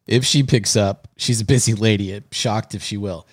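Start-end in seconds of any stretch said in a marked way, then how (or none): none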